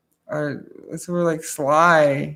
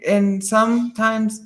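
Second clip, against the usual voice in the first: ordinary talk, nothing marked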